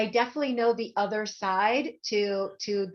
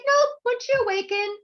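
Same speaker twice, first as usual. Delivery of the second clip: high, louder, emphatic no-going-back; high firm psychic; 12 semitones higher, 7 dB louder than usual. Retold or imitated. The psychic telling her, high and emphatic. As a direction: state it high and loud, slowing at the end. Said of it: "she's like".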